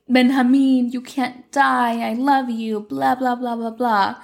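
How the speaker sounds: hammed-up pleading that drifts into dismissive blahs; feigned distressed lover. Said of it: "feigns being, like, the worried and distressed lover".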